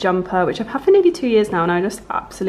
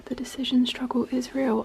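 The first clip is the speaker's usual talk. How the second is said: whispering; 4 semitones higher, 7 dB quieter than usual. Real, not acted. Whispered aside while choosing clothes.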